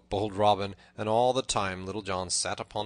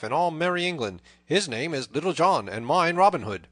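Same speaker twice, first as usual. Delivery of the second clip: loud, raised, lively declaiming; hearty proclamation; 5 semitones higher, 9 dB louder than usual. A narrator's reading. Robin reveals himself to the tanner, proclaiming loudly. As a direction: announce the names with a flourish.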